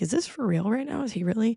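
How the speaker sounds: a quiet, stunned crowd asking each other; confused crowd. Retold or imitated. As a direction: mutter it in disbelief to yourself. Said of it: "everybody was looking around like".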